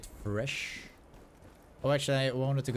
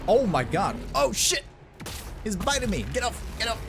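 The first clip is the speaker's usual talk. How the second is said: high panicked shout; panicked yelling; 12 semitones higher, 4 dB louder than usual. Real, not acted. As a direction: yell in real panic. Bear attacking him.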